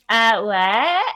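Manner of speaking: light Malibu accent, playing dumb. Shown as a demonstration. Guest showing how they act when feeling dumb, Malibu accent. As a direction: put on a clueless Malibu accent. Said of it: "I'll do the Malibu ac".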